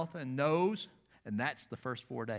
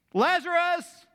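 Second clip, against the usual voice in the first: much higher, louder shout of the name; Jesus summoning Lazarus; loud call; 12 dB louder, slower than usual. Acted. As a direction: call him from the grave. Recreates the call.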